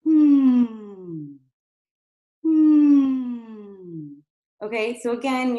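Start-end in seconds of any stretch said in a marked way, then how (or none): 0.0-4.1 louder sighing hum gliding down. Shown as a demonstration. Coach demonstrating a sigh hum to release airflow. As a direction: let the hum fall like a sigh. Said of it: "you can try to do a sigh hum".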